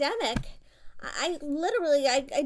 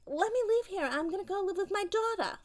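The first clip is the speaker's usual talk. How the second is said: mock exasperation; 8 semitones higher than usual. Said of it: "I was like".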